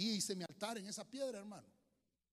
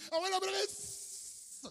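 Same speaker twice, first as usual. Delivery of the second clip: very high, slow, strained cry; boy's cry; 9 dB louder, much slower than usual. Retold or imitated. Scalded boy crying out to his grandmother, very high.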